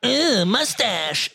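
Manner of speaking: high, slow mock whine of Tyler; imitating Tyler.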